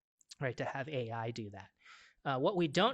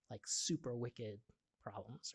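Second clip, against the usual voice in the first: light Boston accent on wicked; a bit of Boston; 2 semitones lower, 7 dB quieter than usual. Real, not acted. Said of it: "that's my Boston accent coming out".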